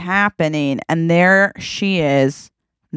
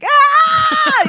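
high, loud shriek of pain; electrocuted scream; 5 dB louder than usual. Pretend. Sister shocked by North's controller: sharp high scream. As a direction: yelp as the shock hits.

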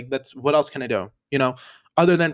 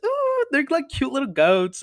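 excited squeal; 10 semitones higher than usual. Real, not acted. Gushing over cute goats.

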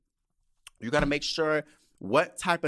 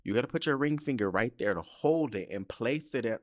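normal talking voice

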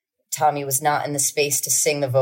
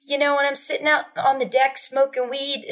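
higher, chatty mom imitation; 10 semitones higher than usual. Mimicking mom chatting to Tommy, higher. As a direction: lightly voice the mom.

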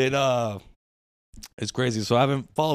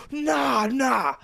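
exuberant shout; 9 semitones higher than usual. Retold or imitated. Imitating how people celebrate hitting the lotto. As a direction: yell it like you hit the lotto.

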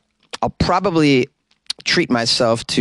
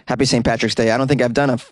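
ordinary talk

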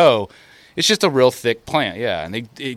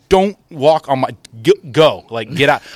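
louder, higher heated telling-off; mad retort; 5 semitones higher, 8 dB louder than usual. Retold or imitated. Telling the old man off, louder and heated. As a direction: push him off the boat with your voice. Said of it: "Just mad".

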